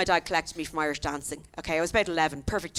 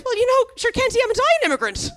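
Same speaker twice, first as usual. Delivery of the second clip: very high, lilting Irish leprechaun; loud, high stage-Irish talk; exaggerated Irish accent; 6 dB louder than usual. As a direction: ham up the Irish charm, pitched right up. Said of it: "I tend to up the Irish accent".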